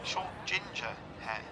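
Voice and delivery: very quiet, low and halting; sheepish realisation. Acted. Friend realising he grabbed someone else's hair, sheepish. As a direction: let it sink in, almost under the breath.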